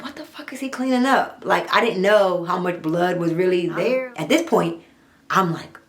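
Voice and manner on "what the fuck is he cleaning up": higher, alarmed disbelief. Retold or imitated. Trapped woman wondering what her captor cleans, higher and alarmed. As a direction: ask it in shocked disbelief. Panicked inner thought.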